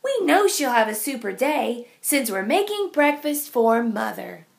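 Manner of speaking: plain reading voice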